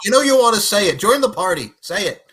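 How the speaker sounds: loud, high coaxing; hyped-up teasing push; egging her on. Real, not acted. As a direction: cheer her into saying it. Egging Nicola on.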